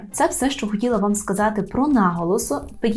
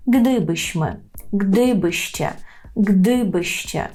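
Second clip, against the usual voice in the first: "Gdybyśmy", "gdybyście" with a drawn-out, stressed GDY; stress on first syllable; much slower than usual. Shown as a demonstration. Teacher showing learners conditional endings, stress on GDY. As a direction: lean on GDY, let "byście" follow unstressed. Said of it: "Gdy наголошуємо".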